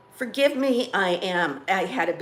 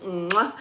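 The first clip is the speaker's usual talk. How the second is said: blown kiss. Real, not acted. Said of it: "P S I love you".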